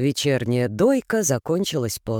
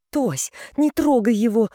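hissing; 8 semitones higher than usual. A narrator's reading. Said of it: "зашипела Галка".

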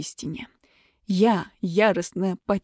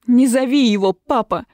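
loud protesting cry; much louder, pleading protest; 3 semitones higher, 7 dB louder than usual. A narrator's reading. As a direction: stop him, cry it out sharply. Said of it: "вскричала я".

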